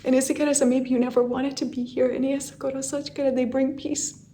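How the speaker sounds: soft, hushed channeling voice with strange syllables; quiet spiritual transmission, fading to near silence; channeled message. Acted. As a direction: pass on a gentle message from beyond.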